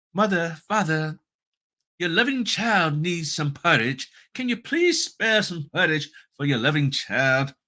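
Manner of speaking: high-pitched, pleading British orphan voice; put-on British accent, high and plaintive. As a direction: beg in a hammed-up British child's voice. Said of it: "I had to do the British voice".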